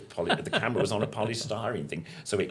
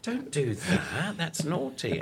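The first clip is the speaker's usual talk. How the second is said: mock scolding; 11 semitones higher, slower than usual. Scolding the puppet Sweep.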